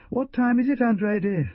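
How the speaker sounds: high, loud anxious question; agitated question. A narrator's reading. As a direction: ask with nervous urgency. Lawyer agitated, demanding the time.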